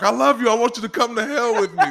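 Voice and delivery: hammed-up menacing devotion; mock crazy fan. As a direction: declare it like an unhinged admirer, joking. Voices a crazy fan, joking.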